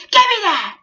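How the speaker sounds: high, loud imitated yell; hammed-up loud grab; mock loud demand. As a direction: bark it like the sheriff snatching the receipt. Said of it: "She raised her voice really loud".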